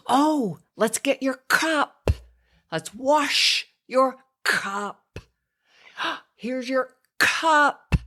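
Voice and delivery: high, slow sing-song stressing cup; exaggerated modelling of cup. Shown as a demonstration. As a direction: talk to a toddler, stretching each cup. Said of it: "And then you say".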